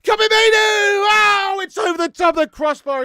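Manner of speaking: yelling at the screen; excited shout. Real, not acted. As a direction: shout it out as the chance flies over. Goal chance in live match.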